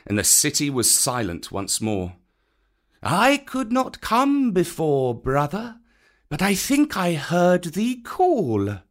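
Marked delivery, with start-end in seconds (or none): 3.0-8.8 slow, high, strange snake voice; python Kaa. A narrator's reading. Kaa the python greeting Bagheera calmly. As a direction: uncoil the words slowly.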